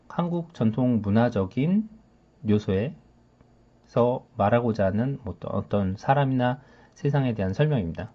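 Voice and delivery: ordinary delivery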